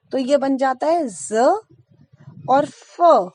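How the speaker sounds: slow "ज" said as z, "फ" said as f; nukta sounds z and f. Shown as a demonstration. Teacher shows students the nukta sounds, careful and slow. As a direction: stretch out "ज" as z and "फ" as f. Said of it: "नुक्ता ... नीचे लगा देते हैं".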